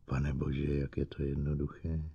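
very soft, low and stunned; low, hushed thinking aloud; hushed realisation. A narrator's reading. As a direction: drop almost to a murmur as it dawns. Stunned realisation after the outburst.

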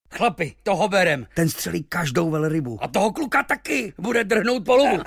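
high, excited sailors' clamour; shouting over each other. A narrator's reading. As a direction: outshout your mates, pitch climbing. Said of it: "hned se překřikovali". (nadšené překřikování námořníků)

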